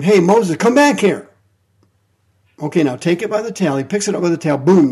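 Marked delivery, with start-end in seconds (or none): 0.0-1.3 loud, higher call after a fleeing man. Acted. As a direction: shout him back, loud and high. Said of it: "Jehovah yells at him".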